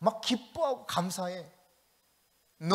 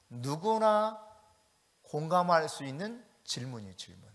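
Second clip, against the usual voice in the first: ordinary talk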